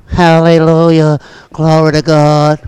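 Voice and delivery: mock a lifeless congregation, low and droning; staid church mimicry. Parodies staid old church worship.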